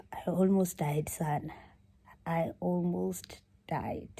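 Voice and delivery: whispered, spent and drained; breathless whisper. Real, not acted. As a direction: barely get the words out. Recovering after the spicy challenge.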